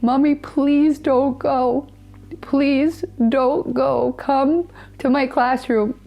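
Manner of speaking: put-on high, slow little boy's plea; son begging. A mother recalls her five-year-old son begging her not to go, high slow pleading child voice.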